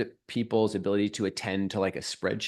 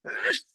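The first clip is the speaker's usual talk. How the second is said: a sudden real sneeze; sneezing; 4 dB quieter than usual. Real, not acted. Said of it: "Yeah, I'm gonna sneeze".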